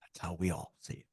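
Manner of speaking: low, very soft retold confiding; hushed, low and confidential; hushed revelation. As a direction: drop right down as if letting a secret out. Retold quiet revelation from fellow medium.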